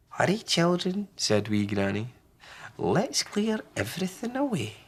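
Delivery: high urgent granny; brisk old lady; wee Granny. Acted. Granny hurries.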